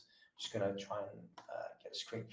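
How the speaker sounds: muttered under the breath while busy; half-whispered to self; whispered aside. Real, not acted. Aside while sharing screen.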